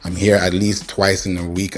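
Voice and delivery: ordinary delivery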